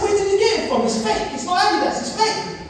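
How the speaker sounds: high, whiny protest; child protesting to mum, high and whiny; outraged. Retold or imitated. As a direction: complain in a high child's whine. Child protesting fake gift.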